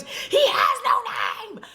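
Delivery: very high, drawn-out mock shriek; freaking out. Girl answers the ne'er-do-wells, freaking out in mock hysteria. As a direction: shriek it slowly like someone hysterical. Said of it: "She is freaking out".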